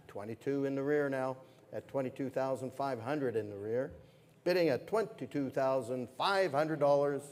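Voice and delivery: brisk, lively auctioneer calling bids; fine art auctioneer. Acted. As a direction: keep the bids rolling like a gala auction.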